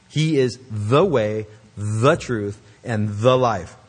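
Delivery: "the way, the truth, and the life" with each "the" pressed; slow, weighting every "the"; stress on each the. Shown as a demonstration. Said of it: "the repetition of the definite article".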